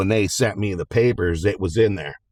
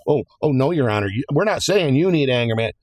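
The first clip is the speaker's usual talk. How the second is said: lightly voiced backpedaling lawyers, higher; mock flustered lawyers; 6 semitones higher, faster than usual. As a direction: hurry to take it back, fast and flustered. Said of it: "they're like".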